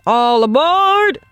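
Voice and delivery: slow, high, loud all-aboard call; conductor calling out. A narrator's reading. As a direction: call it out long to the whole street. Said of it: "the conductor cried out".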